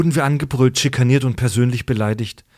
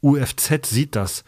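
ordinary delivery

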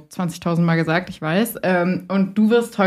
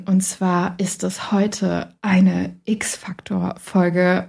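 slow mysterious whisper; hushed secret announcement; much slower than usual. Acted. Teasing the mysterious intro.

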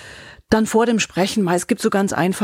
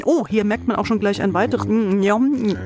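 usual speaking voice

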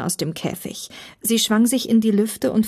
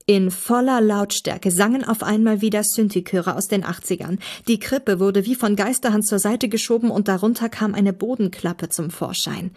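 ordinary delivery, nothing marked

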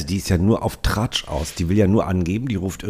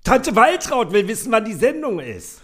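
very high, gushing mother voice; shrill-free but sky-high chatty mum showing off; proud mother on the phone; 3 dB louder than usual. Acted. Podcaster reads the mother's lines bragging to Waltraud, in a very high put-on voice. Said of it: "Du bist Mutter".

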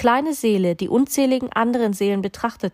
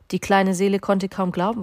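ordinary delivery, nothing marked

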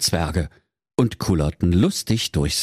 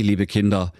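ordinary delivery

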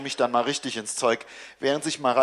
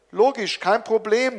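put-on higher, cheerful call to the child; 7 semitones higher, 4 dB louder than usual. A narrator's reading. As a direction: cover up with a cheery shout. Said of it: "rufe ich". (höher, fröhlich gerufen)

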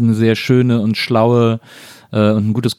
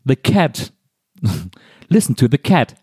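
toy book voice; 3 semitones higher than usual. Retold or imitated. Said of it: "immer so die ganzen Tiere alle durch".

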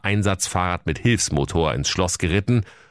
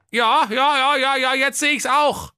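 very high, squeaky king voice; a silly old king, pitched way up; comic king voice. Acted. (hohe quäkige Königsstimme)

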